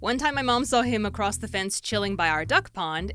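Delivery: normal talking voice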